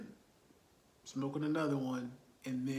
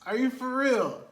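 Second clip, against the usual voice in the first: high, loud incredulous outburst; disbelief; 8 semitones higher, 8 dB louder than usual. Retold or imitated. Viewer reacting to the movie ending, loud disbelief. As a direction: let the disbelief burst out. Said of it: "my reaction was just like".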